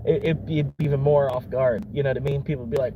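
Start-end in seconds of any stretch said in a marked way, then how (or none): none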